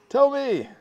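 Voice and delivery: sudden high, loud shout; playful demanding yell. Pretend. Urging audience to yell at him.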